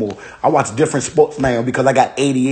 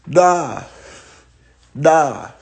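usual speaking voice

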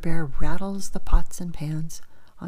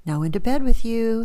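a bit louder, firm bedtime father; father bear; 6 semitones higher, 5 dB louder than usual. Acted. Father bear telling little bear to get into bed. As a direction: send the cub to bed, firmly. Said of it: "he says".

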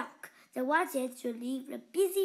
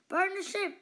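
higher, louder shouted order; angry cry; 7 semitones higher, 5 dB louder than usual. A narrator's reading. Captain paddling away shouting to burn the ship. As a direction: call out the order in fury. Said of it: "he cried as he paddled on".

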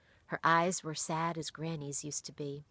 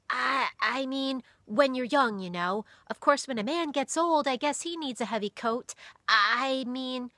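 put-on flustered boy; 7 semitones higher, 6 dB louder than usual. A narrator's reading. Boy backpedalling after insulting the pastor, flustered. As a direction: trip over the words in embarrassment.

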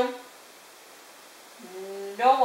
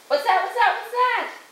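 high, rapid question climbing higher; startled questioning; 10 semitones higher than usual. A narrator's reading. Jason's mother waking to a sound, startled questioning. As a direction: ask it more urgently each time.

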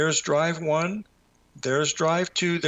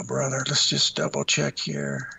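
ordinary delivery

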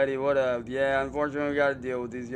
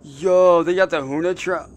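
loud, thrilled outburst; excited shout; 3 semitones higher, 7 dB louder than usual. Real, not acted. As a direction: shout it out, hyped. Spots new car in game.

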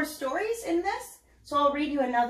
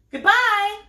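much higher, louder yelled goodbye; shouted goodbye; 8 semitones higher, 8 dB louder than usual. Acted. Pearl storming out on her brother, shouting. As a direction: fling it out as she storms off. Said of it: "shouted Pearl".

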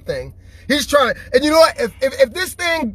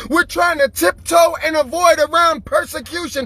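preaching; 6 semitones higher than usual. Acted. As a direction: preach it hard to the crowd. Preaching at full force.

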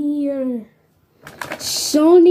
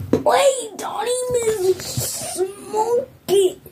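alarmed crying; 6 semitones higher, 4 dB quieter, slower than usual. Pretend. Pretend alarm in toy play.

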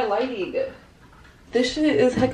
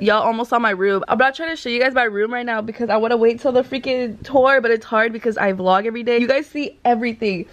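ordinary delivery

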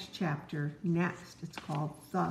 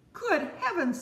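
much higher, wide-eyed gasped words; amazed exclamation; 11 semitones higher, 4 dB louder than usual. Acted. Frog realizes the creature is Toad, much higher. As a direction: Frog startled to recognize Toad.

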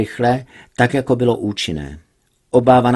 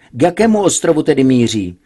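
louder, urgent radio shout; shouted into mic; 4 semitones higher, 6 dB louder than usual. Acted. Max shouts into microphone at pilot, loud. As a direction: yell the question down the line. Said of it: "křikl do mikrofonu Max".

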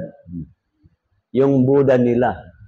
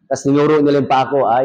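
ordinary talk

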